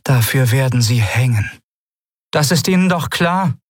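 kühl drohend, zum Ende deutlich höher; kühle Drohung. A narrator's reading. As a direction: mit kühler Stimme Konsequenzen ankündigen. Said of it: "wie kühl ihre Stimme blieb". (kühl und drohend)

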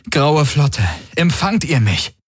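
high, insistent radio call; urgent appeal. A narrator's reading. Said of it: "Er wiederholte seinen Appell". (eindringlicher Funkruf)

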